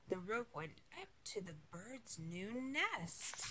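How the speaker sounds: usual speaking voice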